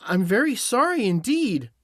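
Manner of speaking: put-on high, apologetic little-girl voice; high girlish apology; frightened Dorothy. A narrator's reading. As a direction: apologize as a scared young girl. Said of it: "who was truly frightened".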